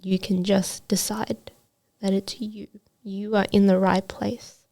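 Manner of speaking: soft, near-whispered retelling of advice; quietly repeated words of comfort; hushed reassurance. Retold or imitated. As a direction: let the words sink in, almost whispering. Repeats coach's advice, softly for effect.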